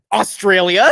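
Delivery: loud, high bluff in a hint of Australian; mock Australian accent. Caught mimicking, bluffing to the stranger in mock Australian. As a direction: pass yourself off as Australian, badly. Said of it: "So I said".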